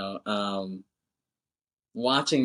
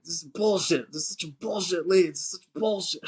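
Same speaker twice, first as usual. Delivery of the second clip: higher-pitched retold griping; mock indignant complaint; 6 semitones higher than usual. Mexican guy griping about a bad lead, mock indignant. Acted angry complaint of film character.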